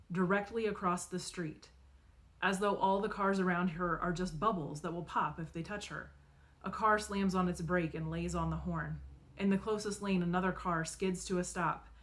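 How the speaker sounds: usual speaking voice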